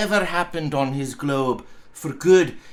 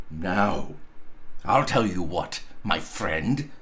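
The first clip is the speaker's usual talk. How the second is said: feigned stern threat; 9 semitones lower, 5 dB quieter, slower than usual. Acted.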